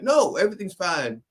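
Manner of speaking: high mock first lady; first lady dismissive. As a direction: brush them off breezily. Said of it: "And she'd be like".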